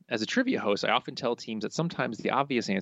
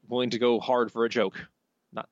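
normal talking voice

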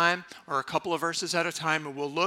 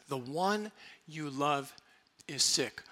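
softer, slow and lingering; tender echo; 3 semitones lower, 6 dB quieter, slower than usual. Shown as a demonstration. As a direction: savour the phrase quietly. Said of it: "What a beautiful phrase".

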